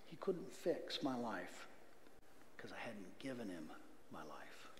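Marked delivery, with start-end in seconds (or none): 2.6-4.7 hushed whisper of realisation; whispered, awestruck confession. Real, not acted. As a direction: breathe it out like a confession. Solemn realisation in testimony.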